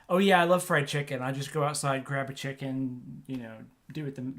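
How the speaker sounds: usual speaking voice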